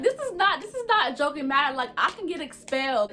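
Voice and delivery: lightly replayed outrage at a friend who laughed; indignant protest. Upset telling laughing friend off.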